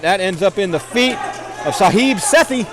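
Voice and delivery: ordinary delivery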